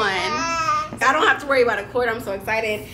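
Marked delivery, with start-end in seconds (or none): none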